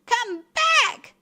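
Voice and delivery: high, loud calling out; wife's cry. A narrator's reading. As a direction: cry out after a runaway. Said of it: "cried his wife".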